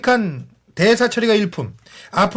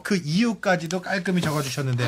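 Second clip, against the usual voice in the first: usual speaking voice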